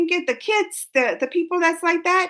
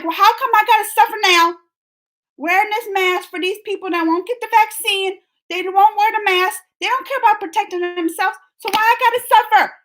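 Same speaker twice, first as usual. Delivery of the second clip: mock indignant rant; 6 semitones higher than usual. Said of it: "so I'm getting mad".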